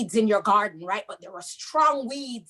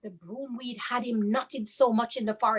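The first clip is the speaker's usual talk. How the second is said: usual speaking voice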